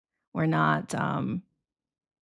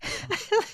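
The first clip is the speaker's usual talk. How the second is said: airy laugh; 5 dB quieter than usual. Real, not acted. Laughing at her own story.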